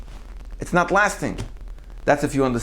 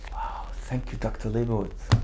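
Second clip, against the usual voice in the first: whispered, much softer thank you; hushed thanks; 2 semitones lower, 11 dB quieter than usual. Retold or imitated. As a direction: breathe the thanks out softly. Wife imagining quiet gratitude.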